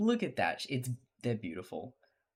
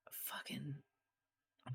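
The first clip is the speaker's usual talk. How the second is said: whispered aside; 3 semitones higher, 13 dB quieter than usual. Real, not acted. Hushed aside mid-sentence.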